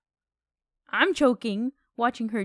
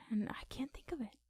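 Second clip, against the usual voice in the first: breathy whisper, fast; 13 dB quieter, faster than usual. Real, not acted. Host trying to remember a movie, whispered to himself. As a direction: mutter it to yourself under the breath. Trails off while trying to recall.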